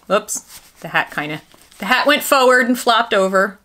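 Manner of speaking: ordinary talk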